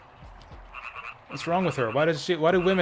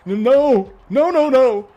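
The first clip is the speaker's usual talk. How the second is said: much higher, louder anguished cry; dramatic shout; 11 semitones higher, 11 dB louder, slower than usual. Acted. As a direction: wail in horror at the mistake. Voiced NPC discovering mistake.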